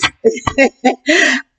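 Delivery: amused high chuckle; laugh. Real, not acted. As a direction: chuckle at your own remark. Amused by own sexy liars remark.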